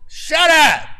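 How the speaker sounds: high, loud yell at a dog; ornery shout. Retold or imitated. Preacher retells yelling at the barking dog, loud shout. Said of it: "in an ornery mood went".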